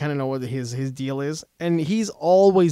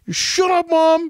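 mock defiant yell; 11 semitones higher, slower than usual. Timid kid finally talking back to his mom, mock defiant yell. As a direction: snap suddenly into a high yell.

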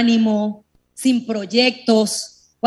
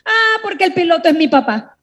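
put-on proud little boy; 7 semitones higher, 3 dB louder than usual. Boy's punchline in story.

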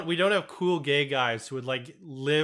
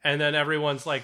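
ordinary talk, nothing marked

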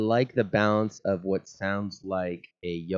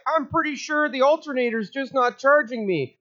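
whiny battery voice; 14 semitones higher than usual. Pretend. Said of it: "The battery's like".

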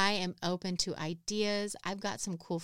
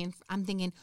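ordinary talk, nothing marked

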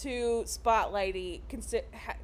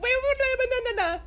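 mock panic; 11 semitones higher, 4 dB louder, faster than usual. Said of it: "they're like".

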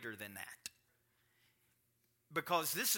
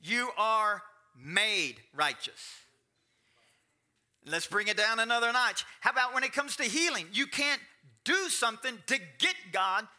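high and loud pulpit delivery, pressing each point; preaching at full force; 9 semitones higher, 7 dB louder than usual. Acted. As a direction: hammer the point home to the congregation.

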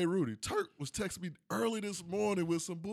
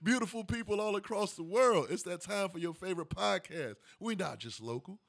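big hype host intro, raised; grand entrance announcement; 5 semitones higher than usual. Acted. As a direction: announce the show like a ring MC. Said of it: "host's grand opening announcement".

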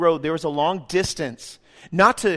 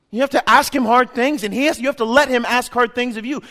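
ordinary talk, nothing marked

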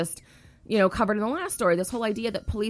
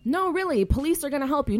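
mocking quote; 8 semitones higher, 4 dB louder than usual. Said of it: "the rescue movement are still like".

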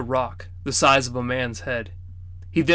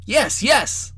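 put-on eager kids; 10 semitones higher than usual. A narrator's reading. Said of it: "jumping up and down".

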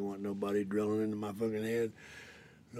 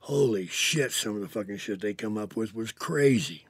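usual speaking voice

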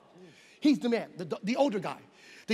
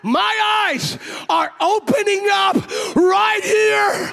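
high, loud cries of amazed joy; ecstatic shouting; 8 semitones higher, 7 dB louder, much slower than usual. Retold or imitated. Blind father crying out that he can see, ecstatic shouting. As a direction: shout it over and over, overcome by the miracle.

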